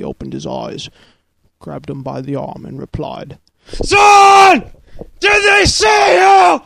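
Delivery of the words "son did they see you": high, strained full-volume yell; wounded father. A narrator's reading. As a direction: bellow slowly as a hammed-up dying father. Wounded father shouting to his son.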